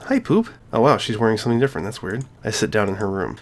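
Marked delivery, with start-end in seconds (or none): 0.0-0.5 put-on high, girlish greeting; Sayori's voice. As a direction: chirp it up high like a cheerful girl.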